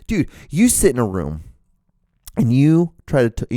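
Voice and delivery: ordinary talk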